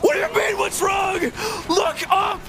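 high shrieked reply; mock hysterical yell. Housemate crying on floor, high shouted reply. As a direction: shriek it in disbelief. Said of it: "she's like".

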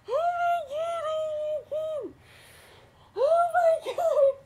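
high, wailing oh my God, half laughing half crying; mock-sob in horror at the screen; horrified wail. Vlogger reacting to a gory film scene, horrified wail.

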